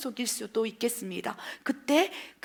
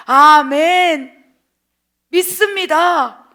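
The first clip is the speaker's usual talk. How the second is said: drawn-out, louder amen of faith; slow, loud fervent response; 2 semitones higher, 13 dB louder, much slower than usual. Acted. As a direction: cry out your belief. Believer answers God's word.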